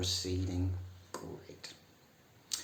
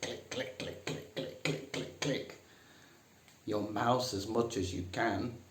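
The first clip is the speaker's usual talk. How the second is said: ordinary talk, nothing marked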